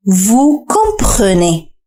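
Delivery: "Vous comprenez" again, very slow and even; slow repeat. Shown as a demonstration. Teacher repeats the model for learners, very slowly. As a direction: let "Vous comprenez" unfold syllable by syllable. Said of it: "استمع جيدًا".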